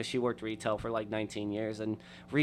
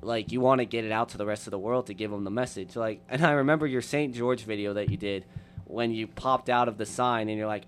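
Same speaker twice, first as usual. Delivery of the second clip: normal talking voice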